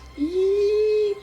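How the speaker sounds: very high, sustained squeal; high excited-style squeal held on one note; Tally voice squeal. Acted. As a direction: let out a long high-pitched squeal. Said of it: "in your best Tally voice".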